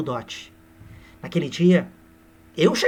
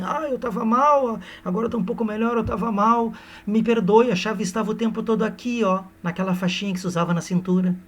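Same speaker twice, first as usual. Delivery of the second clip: mock drunk lady; 5 semitones higher than usual. Aurélia waking drunk, telling Seixas where the key was. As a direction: play a woozy just-woken drunk. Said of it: "Ela acorda bêbada e diz assim".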